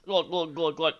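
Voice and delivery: mock glug glug swallowing; gulping sounds. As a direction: say it like gulping water. Imitating gulping a drink.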